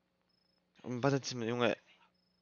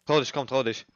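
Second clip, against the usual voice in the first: taunting; 3 semitones higher, 8 dB louder than usual. Pretend. Taunting the killer loudly.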